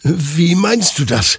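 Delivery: high, loud, bewildered question; confused. A narrator's reading. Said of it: "stammelte Hector verwirrt".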